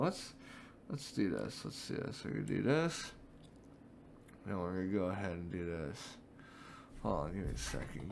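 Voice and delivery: hushed, low muttering to himself; low quiet self-talk. Real, not acted. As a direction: drop to a murmur while setting up. Getting ready quietly.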